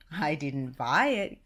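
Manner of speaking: slow, lightly voiced Goofy. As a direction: drawl it like Goofy. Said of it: "Goofy answered".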